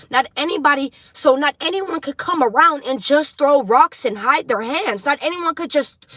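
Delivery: loud, high, fired-up rant; heated shout. Real, not acted. Reader shouting at hypocrites to her collective. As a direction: let the anger build and shout it.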